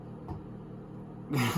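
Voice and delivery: quiet unvoiced chuckle; embarrassed little laugh; breathy laugh. Real, not acted. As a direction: laugh it off under your breath. Laughing off a stumble.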